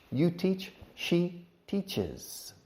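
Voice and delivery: "teaches" said clearly as two syllables, tea-ches. Shown as a demonstration. Teacher showing the added syllable in teaches. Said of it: "Two syllables and teaches".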